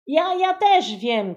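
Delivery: put-on high, loud child's shout; eager boy cutting in loudly. A narrator's reading. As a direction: yell to get a word in. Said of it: "krzyknął Kuba".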